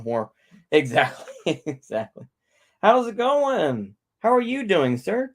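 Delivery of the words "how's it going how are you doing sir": high, slow greeting. Real, not acted. Greets stream viewers.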